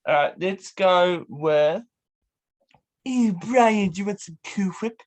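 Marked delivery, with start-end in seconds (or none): 3.0-5.0 higher-pitched cartoon character impression; Stewie. Guest giving an impression for the host to guess, Stewie voice. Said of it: "it's Stewie".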